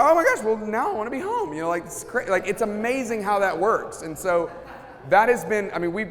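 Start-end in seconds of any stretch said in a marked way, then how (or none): 0.0-1.5 much higher, louder gush of joy; gushing delight. Retold or imitated. Husband touched by his wife's blessing, gushing happily. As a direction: swoon as if suddenly longing for home. Husband's happy change of heart.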